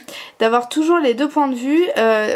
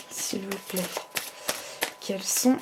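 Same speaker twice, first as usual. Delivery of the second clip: soft pleading; 4 semitones lower, 12 dB quieter than usual. Real, not acted. Softly begging the cards to answer.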